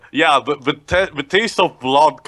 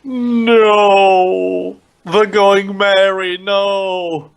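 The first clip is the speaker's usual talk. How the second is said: high, drawn-out melodramatic lament; mock anguished wail; 6 semitones higher, 3 dB louder, much slower than usual. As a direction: wail in hammed-up grief for a lost ship. Mock grief for a ship.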